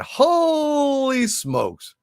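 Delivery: a long, drawn-out, high holy smokes; slow, high, awestruck holy smokes; amazed. Real, not acted. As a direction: stretch Holy smokes out slowly, stunned. Amazed at a big super chat.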